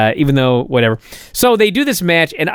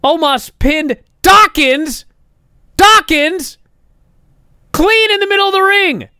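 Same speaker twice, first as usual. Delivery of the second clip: higher and louder with each phrase; rising disbelief; 11 semitones higher, 3 dB louder than usual. Real, not acted. Reviewer recounting the absurd finish, climbing higher in disbelief. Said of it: "outraged recap of finish".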